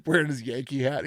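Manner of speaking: ordinary talk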